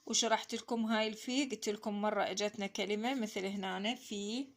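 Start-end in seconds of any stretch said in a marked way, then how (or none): none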